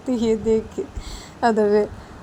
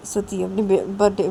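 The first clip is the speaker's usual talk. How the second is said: ordinary delivery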